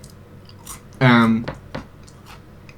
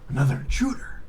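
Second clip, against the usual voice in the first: Snake impression; 8 dB quieter than usual. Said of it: "And then Snake says".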